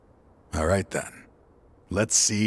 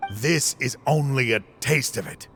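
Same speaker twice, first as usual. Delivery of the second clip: ordinary talk, nothing marked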